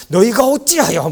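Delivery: high, loud indignant declamation; dramatic voice-actor tone. Retold or imitated. Imitating the Bible app's voice actor, dramatic indignation. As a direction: imitate an audio-drama apostle's outrage. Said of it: "막 이렇게 얘기하는데".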